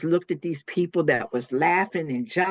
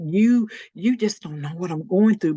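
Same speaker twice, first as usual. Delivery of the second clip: retold lashing out, a little higher; mean retort; 4 semitones higher, 4 dB louder than usual. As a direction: throw it back at a friend, mildly heated. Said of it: "I was so mean to her".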